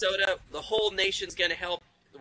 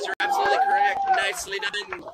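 excited praise; 6 dB louder, faster than usual. Real, not acted. Host cheers a correct answer.

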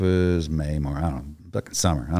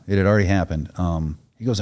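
usual speaking voice

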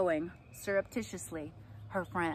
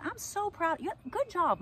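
much higher, beaming praise; gushing lady; 12 semitones higher than usual. Retold or imitated. Fair woman congratulating mom, high gushing voice.

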